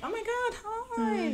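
very high, gushing hello; excited greeting. Retold or imitated. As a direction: squeal a surprised hello. Bumping into a friend.

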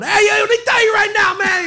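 loud, high New York yell; aggressive uncle shouting. Retold or imitated. Nephew imitating his aggressive uncle, hammed-up loud shout. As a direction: bark it at the whole bar like a hothead. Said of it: "the aggressive one".

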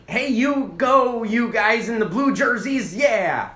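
loud, high, drawn-out fan cheer; cheering yell. Acted. Fan yelling fun things at the game, loud cheer. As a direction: holler slow encouragement across a stadium. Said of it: "I was just yelling fun things like".

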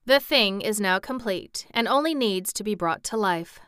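ordinary delivery

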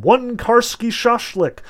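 high, loud food order called out; commanding order call. A narrator's reading. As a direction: call orders out as if through a megaphone. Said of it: "a voice commanded".

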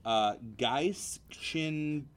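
ordinary delivery

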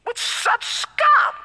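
snooty, high, drawn-out disdain; bougie high voice. Acted. Preacher mimics Pharisees questioning the disciples, bougie high voice. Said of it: "my voice just went to that ... got high voices".

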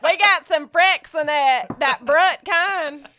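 loud, slow southern mama. Pretend. Asked to try, she does southern mama, loud and slow. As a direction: play the bossy southern mom. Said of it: "take a shot at it".

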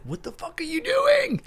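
very high, exasperated mock shriek, held in volume; acted swearing outburst. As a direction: let the curse out in a high strained cry. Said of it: "when you wanna fucking swear".

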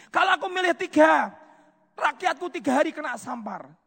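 high, loud desperate pleading; pleading king. Acted. David begging God over the three punishments, desperate. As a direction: beg God not to make him choose.

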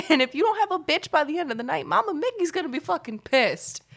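high, bossy mock aunt; imitating Aunt Mickey. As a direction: threaten him in a high playful aunt voice. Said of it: "She's like".

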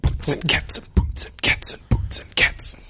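whispered beatbox. Acted.

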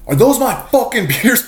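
high, indignant mock yell; angry accusation. Glenn spots his stolen beers, mock outrage. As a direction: imitate a friend catching a beer thief. Retold accusation of beer theft.

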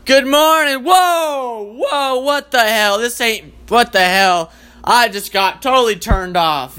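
high, recoiling wake-up; grossed-out partner. Retold or imitated. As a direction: pull back in disgust from bad breath. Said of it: "then it's like".

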